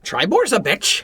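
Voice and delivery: the bird mocks Tribor in a high slow voice; talking bird. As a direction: relish the insult as a parrot.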